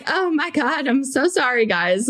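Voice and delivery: high-pitched, mock-flustered apology. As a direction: apologize to followers in an acted fluster. Acted flustered apology to followers.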